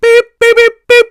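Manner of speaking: high, loud electronic beeps; microwave beeps. Retold or imitated. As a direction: beep like a finished microwave. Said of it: "A microwave".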